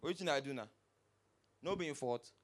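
low dismissive mumble of the driver; Pidgin guy. Retold or imitated. Driver denying fault to the victim, low and dismissive. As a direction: shrug off the blame, low. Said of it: "The guy just came out like this".

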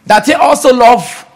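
loud, high, insistent proclamation; preaching at full force. Acted. Preacher tells congregation love is a law, preaching at full force. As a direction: hammer the new law into the congregation.

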